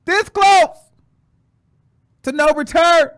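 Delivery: high, loud, emphatic cry; dramatic warning. Acted. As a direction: punch it out as a dire warning.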